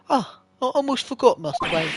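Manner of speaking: a lightly done butler bowing out; the bellboy as a Batman butler taking his leave, lightly voiced; Michael Caine. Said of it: "let's do a Michael Caine voice".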